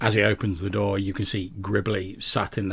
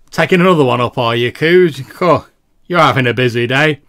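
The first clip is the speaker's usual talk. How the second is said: loud, much higher brash barkeep shout; 5 semitones higher, 15 dB louder than usual. Acted. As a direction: bellow it across the bar like a rowdy barkeep. Barkeep greets the NPC loudly.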